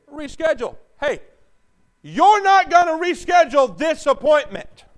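loud, high shouted preaching; fiery preacher shout; full-force preaching. Acted. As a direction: hammer it home from the pulpit.

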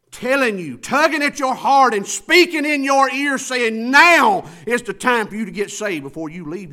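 loud, high-pitched pulpit cry; full-force preaching. Real, not acted. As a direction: drive it at the congregation, stretching the big words.

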